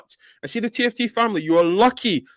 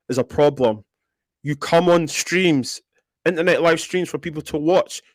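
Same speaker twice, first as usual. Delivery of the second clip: usual speaking voice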